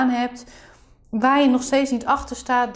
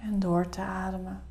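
soft spa whisper; 3 semitones lower, 9 dB quieter than usual. Acted. Leads a guided meditation.

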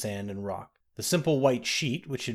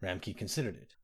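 ordinary delivery

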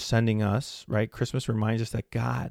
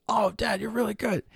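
put-on high, childlike praise; voicing his little son; 11 semitones higher than usual. Father imitating his young son praising him. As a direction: play the impressed kid.

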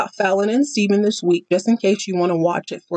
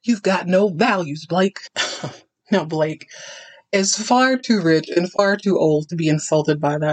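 ordinary delivery

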